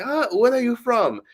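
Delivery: put-on high, accented call to a stranger; imitated street man. Man on the street asking a passerby, imitated accent.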